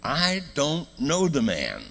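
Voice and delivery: slow, high, forceful denial; emphatic sworn denial. Acted. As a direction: stretch each word out as if swearing an oath. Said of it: "He denied it again with an oath".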